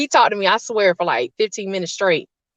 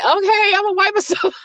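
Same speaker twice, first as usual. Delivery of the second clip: put-on higher, small girl's answer; high timid teen voice; 12 semitones higher than usual. Scared teen answering her father's ultimatum, high and small. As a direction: squeak it out like a scared teenager. Teen self obeying dad.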